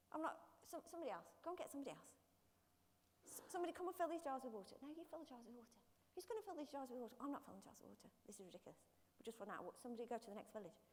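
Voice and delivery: soft, overlapping-style servant squabble; hushed bickering. Acted. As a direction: grumble and pass the job along quietly. Acted squabble among reluctant servants.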